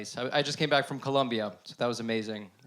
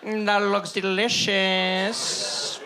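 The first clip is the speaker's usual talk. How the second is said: savoring; 4 semitones higher, much slower than usual. Pretend. Eyeing a placenta hungrily.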